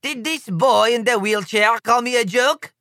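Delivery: put-on lightly accented, offended; a touch of French indignation; outraged diplomat. A narrator's reading. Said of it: "screams another diplomat".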